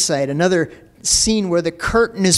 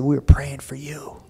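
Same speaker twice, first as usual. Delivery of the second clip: whisper; 2 semitones lower than usual. Retold or imitated. Whispered reply to neighbor.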